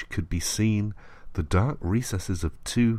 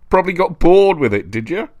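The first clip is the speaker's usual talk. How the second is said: loud, higher sneering jab; mock him with scorn; taunting; 8 semitones higher, 8 dB louder than usual. A narrator's reading. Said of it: "said the voice".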